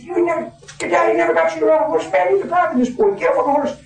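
high, quick mock scolding; teasing outfitter. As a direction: rib the father for never teaching his boy.

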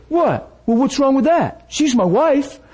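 high, pushed-up protest; defensive man, high and startled; indignant husband. Retold or imitated. Husband defending himself to the pastor, high and indignant.

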